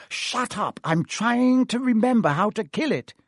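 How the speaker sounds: irritated. Acted. Hermione snapping at Ron.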